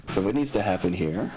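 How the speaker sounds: usual speaking voice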